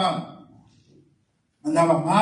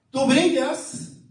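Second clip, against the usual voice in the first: rising fervor; 6 semitones higher than usual. Real, not acted. Preacher exalting the congregation. (exaltación creciente, lenta y aguda)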